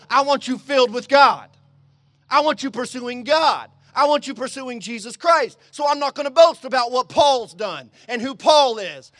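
loud, driving sermon delivery; urgent, emphatic pulpit voice; forceful preaching. Acted. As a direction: preach it hard, punching key words.

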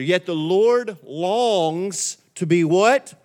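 slow, drawn-out scripture reading, word by word; leading unison reading. Real, not acted. Pastor leading the congregation in reading Isaiah together, slow and emphatic. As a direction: stretch each phrase so everyone can read along. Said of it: "Let's read this together".

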